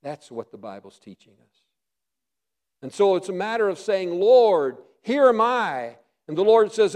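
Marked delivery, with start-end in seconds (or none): 4.2-6.0 louder, ringing offer of self; a believer offering himself to God; fervent call. Acted. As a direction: call it out in full surrender. Preacher voicing surrender.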